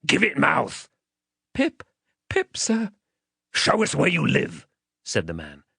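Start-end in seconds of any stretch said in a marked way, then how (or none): 0.1-0.9 higher, threatening demand; menacing man. Acted. Man demanding the child's name, menacing. 1.5-2.9 put-on small, high-pitched child's answer; frightened child. Child answering the threatening man, timid. 3.5-4.7 higher, threatening demand; menacing man. Acted. Man demanding the child's name, menacing.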